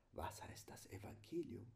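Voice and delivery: unvoiced whispered question. Shown as a demonstration. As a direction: whisper it with no voice at all. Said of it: "Das Evangelium geflüstert". (leise geflüsterte Frage)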